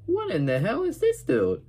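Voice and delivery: high incredulous squeak; incredulous what. Retold or imitated. Kid recalling his own reaction to Joey, high and baffled. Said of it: "And I was like".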